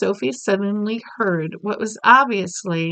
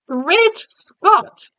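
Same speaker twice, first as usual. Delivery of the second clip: shocked exclamation; 10 semitones higher, 5 dB louder than usual. Acted. Queen reads shocking headline.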